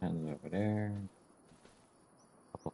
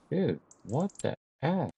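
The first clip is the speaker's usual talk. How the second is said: slow, higher, louder disbelief; incredulous; 8 semitones higher, 6 dB louder, slower than usual. Real, not acted. Gamer reacting to late sunrise in slow disbelief. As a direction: drag out the outrage.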